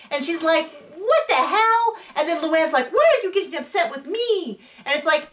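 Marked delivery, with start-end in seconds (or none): 1.0-1.9 imitating an outraged reaction. Bethany reacting to Luann. 2.9-4.6 mock defensive whine. Luann defending herself.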